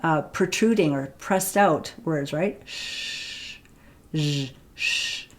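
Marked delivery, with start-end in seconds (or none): 2.6-5.3 lips pushed out on "Shh" and "zh"; sh zh demo. Shown as a demonstration. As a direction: protrude lips, hiss then buzz. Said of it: "you can see that my lips".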